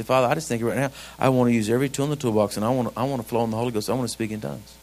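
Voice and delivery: low, hushed praying voice; earnest, low-pitched demonstration prayer; private prayer. Shown as a demonstration. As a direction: pray it quietly and quickly to the Father. Models a private quiet-time prayer.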